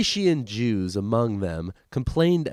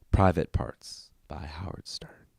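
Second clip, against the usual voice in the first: whisper; 8 semitones lower, 6 dB quieter than usual. Real, not acted. Closing off the book reading.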